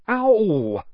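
sudden loud high ow; yelp of pain. A narrator's reading. Lockhart kicked in the shins, loud yelp.

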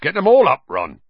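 hearty encouraging call; jolly, urging him on; cheerful. A narrator's reading. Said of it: "he said cheerfully".

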